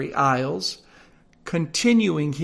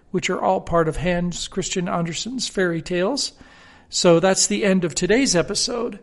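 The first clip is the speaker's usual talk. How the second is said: ordinary delivery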